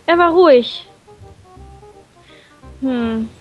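ordinary talk

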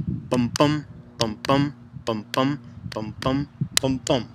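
ten "bum" beats, each pair rising on the second; iambic beat. Shown as a demonstration. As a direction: count out "bum, bum" in da-DUM rhythm. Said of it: "So five iambs is ten, ten heartbeats".

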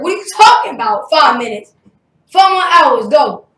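loud, incredulous retold reprimand; mock scolding dad. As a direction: order the kid back to work, loudly. Imitates dad scolding him.

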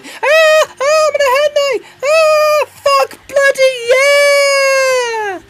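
shrieking party-girl impression, very high and loud; imitated hen-night screaming. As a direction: squeal wildly, stretching the final cheer out long. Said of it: "screaming out the windows".

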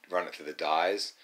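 normal talking voice

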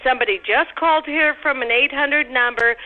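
ordinary delivery

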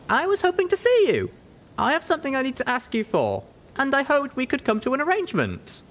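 put-on high, earnest young pony voice; Little Pip, eager. Little Pip asks the griffin merc for a deal, eager and high. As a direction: ask the favour hopefully. Pip's character voice.

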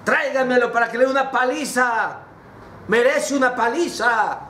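high, forceful royal command; acted outraged father; angry king. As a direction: order the guards about, angry and high-pitched. Furious king orders son found.

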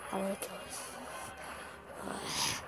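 slow, barely audible whisper; despairing whisper. Real, not acted. Player worn down by annoying opponent, despairing whisper. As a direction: whisper it slowly to yourself. Exasperated repeat to himself.

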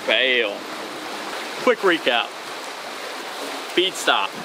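ordinary delivery

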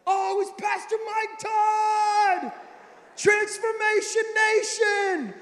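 hammed-up fan shout, high and slow; imitating a restaurant erupting, drawn-out yell; excited crowd. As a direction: holler it long like an adoring crowd. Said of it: "the whole restaurant is like".

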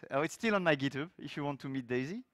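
normal talking voice

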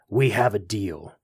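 low, beaten, giving in; trapped devil grudgingly giving up; hoarse, defeated. A narrator's reading. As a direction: concede through gritted teeth, worn out. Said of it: "Old Nick's voice was hoarse, defeated".

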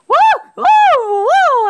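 loud high whoops; whooping cheer. Real, not acted. Gamer cheering at his screen, loud high whooping. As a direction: whoop like you just won. Celebrating in game.

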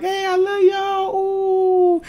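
very high, slow, sing-song mom voice; drawn-out high greeting like a cheery mother. Retold or imitated. Mother greeting her kids at the door, high slow sing-song.

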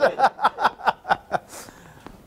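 real, high burst of laughter; hearty laugh. Real, not acted. Lecturer amused by his own tease, hearty laugh. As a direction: keep laughing helplessly.

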